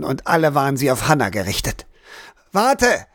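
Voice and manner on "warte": high, sharp call after her. A narrator's reading. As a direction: shout her name-like, to stop her. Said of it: "rief er ihr hinterher".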